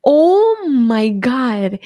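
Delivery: long drawn-out, slow exclamation; retold astonishment. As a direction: stretch it out in mock shock. Retelling shock at going viral.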